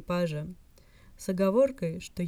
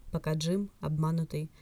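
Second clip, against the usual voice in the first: ordinary delivery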